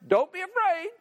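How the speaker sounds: lightly mock a heavenly messenger; angel's greeting.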